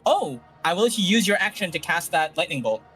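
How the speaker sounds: ordinary talk, nothing marked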